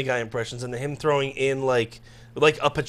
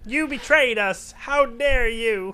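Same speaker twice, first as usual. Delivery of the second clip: slow, high mock accusation; hammed-up comic-book indignation; high cartoon superhero voice; 7 semitones higher, much slower than usual. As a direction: draw out each word in cartoon outrage. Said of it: "If you do any voices".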